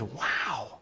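very soft, hushed wow; awed wow. Acted. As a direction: breathe out a small acted wonder. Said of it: "wow quite with the same enthusiasm".

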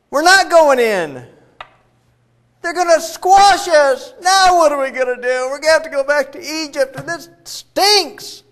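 high, loud, dragged-out scared moaning; panicked whining. Retold or imitated. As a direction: whine like a terrified crowd. Scared people whining after spies return.

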